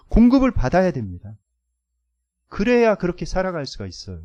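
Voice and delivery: loud, rising sermon exhortation; forceful preaching. Acted. Pastor urging the congregation, loud and emphatic. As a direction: drive the point home to the pews.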